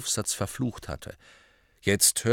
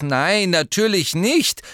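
hasty denial; 9 semitones higher, 6 dB louder than usual. A narrator's reading. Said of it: "warf Harry rasch ein".

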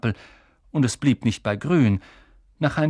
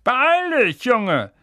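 high, loud impatient call; 10 semitones higher, 5 dB louder, much slower than usual. Acted. Uncle calling the boy from the kitchen, impatient shout. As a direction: yell it through to the next room. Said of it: "rief Onkel Vernon aus der Küche".

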